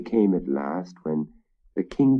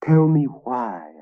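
pleading; 5 semitones higher, slower than usual. Acted. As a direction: beg her for an answer. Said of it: "I pleaded".

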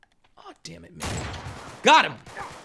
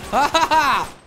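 slow, high gleeful laugh; real gleeful laughter; 8 semitones higher, 6 dB louder, slower than usual. Real, not acted. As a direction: cackle with delight at the play. Laughs at own kill.

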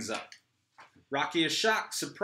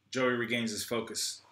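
ordinary talk, nothing marked

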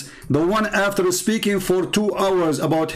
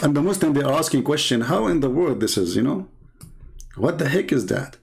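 ordinary talk

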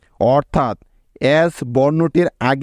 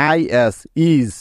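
Is, is spelled out very slowly; slow classroom spelling; slow spelling demo; 2 semitones lower, much slower than usual. Shown as a demonstration. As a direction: drag out is then is like a teacher. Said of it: "যেমন দেখো".

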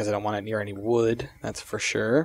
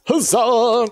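high, loud victory shout; triumphant cheer; 12 semitones higher, 6 dB louder than usual. Real, not acted. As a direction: cheer it out like a knight.

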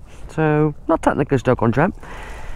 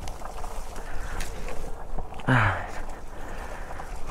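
long weary sigh; 7 semitones lower, 12 dB quieter than usual. Real, not acted. A long breath after the climb.

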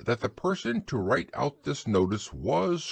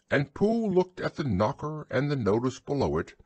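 ordinary delivery

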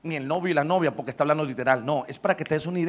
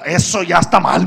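louder scolding uncle's complaint; angry uncle; 3 semitones higher, 12 dB louder than usual. Retold or imitated. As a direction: tell him off, annoyed. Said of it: "estaba enojado de veras". (tío enojado regañando)

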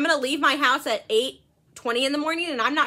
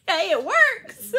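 laughing; 7 semitones higher, 4 dB louder, much slower than usual. Real, not acted. Laughing with cohost.

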